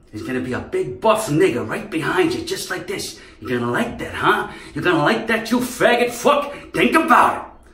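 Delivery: high, loud menacing mock villain; movie villain impression. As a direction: hiss threats like the crazy guy.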